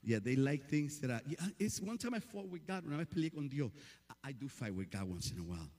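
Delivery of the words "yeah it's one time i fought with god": ordinary talk, nothing marked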